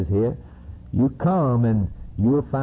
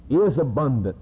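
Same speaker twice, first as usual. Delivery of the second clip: ordinary delivery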